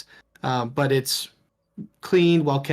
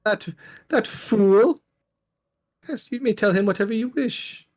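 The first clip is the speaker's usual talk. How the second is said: higher, contemptuous noblewoman; scornful lady; 7 semitones higher than usual. Acted. Lady Wachter scorning the Burgomaster, higher and contemptuous. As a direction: spit out the scorn, then wave it off.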